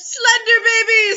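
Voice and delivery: high, loud, drawn-out horrified cry; horrified shout. Real, not acted. Co-host shouts in horror at the thought of slender babies. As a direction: wail it in mock horror.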